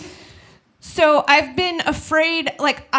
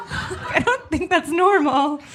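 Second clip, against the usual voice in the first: laughing; 4 semitones higher, slower than usual. Real, not acted. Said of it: "repeated line".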